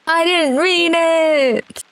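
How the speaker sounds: very high, stretched-out mock plea; melodramatic wail. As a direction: wail the apology slowly and theatrically. Mock plea after being shot.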